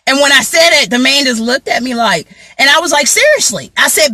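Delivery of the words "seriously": high, pointed challenge; incredulous. Retold or imitated. Turning to the eavesdropping man, incredulous. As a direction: ask it with raised eyebrows.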